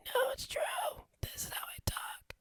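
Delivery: high, very soft wheezy whisper; comical sick-friend voice. Retold or imitated. Friend teases host over the phone in a comical whispery sick voice. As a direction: put on a thin, breath-only sick voice.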